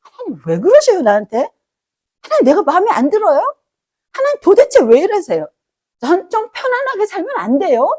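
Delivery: put-on a child nagging a parent who won't listen; whiny complaint to God. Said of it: "마음에 서운함이 슥 올라오기도".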